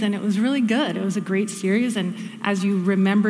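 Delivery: usual speaking voice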